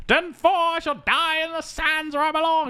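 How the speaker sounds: high-pitched, theatrical dying declaration; Winston. Acted. Pilot welcoming death in the sands, high mock-heroic voice. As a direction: proclaim it in hammed-up heroic style. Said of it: "Winston's like".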